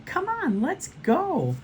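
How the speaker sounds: very high, eager call; excited urging. A narrator's reading. Pablo urges friends toward the rides, excited.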